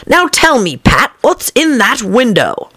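high, slow stern questioning; stern rabbit. A narrator's reading. The Rabbit questioning Pat, high and slow. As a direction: demand an answer from the servant. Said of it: "said the rabbit angrily".

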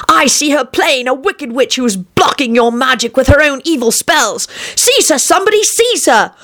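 high, urgent accusation; charlatan's shout. A narrator's reading. Charlatan denouncing a witch to the king, shouting high. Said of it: "he shouted".

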